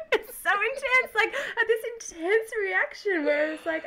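high, laughing through words. Real, not acted. After telling her rage story, laughing. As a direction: still giggling at her own rage.

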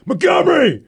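sharp high bark of a name across the field; coach yelling name. Retold or imitated. Coach calling the player over the practice field, loud yell. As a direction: call him over like an angry coach.

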